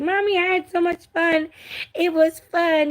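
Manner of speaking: put-on high, happy little girl voice. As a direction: squeak it out like a delighted four-year-old. Voicing four-year-old daughter.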